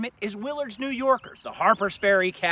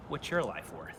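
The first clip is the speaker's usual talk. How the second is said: hushed and grave, much softer; solemn quiet question; 4 semitones lower, 12 dB quieter than usual. Real, not acted. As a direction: let the question sink in softly. Rhetorical question after casualty toll.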